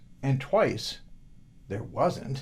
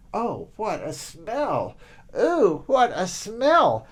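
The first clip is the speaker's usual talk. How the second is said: mock disgust; 6 semitones higher, 3 dB louder, slower than usual. Boy repeats walking.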